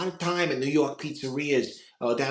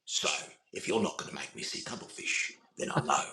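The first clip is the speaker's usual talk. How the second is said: low, hushed arms-dealer villain; mostly whispered, halting menace; Ulysses Klaue; 7 semitones lower, 6 dB quieter than usual. Acted. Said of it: "Ulysses Klaue from Avengers two".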